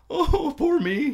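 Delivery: high, slow, whiny "Oh, poor me"; mocking a heartbroken songwriter, high and drawn out; mock self-pity. Friend mocks sad love songs, in a high, slow whine.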